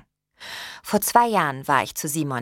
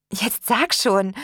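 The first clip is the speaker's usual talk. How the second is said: slow, higher-pitched prompting; insistent, stretched urging; urging demand; 5 semitones higher, 4 dB louder, slower than usual. A narrator's reading. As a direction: press them to speak, drawing it out. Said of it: "forderte ich ihn auf".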